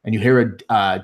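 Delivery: ordinary delivery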